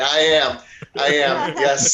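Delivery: loud eager answer; eager reply. Retold or imitated. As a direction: shout back eagerly at the screen. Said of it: "I'm like".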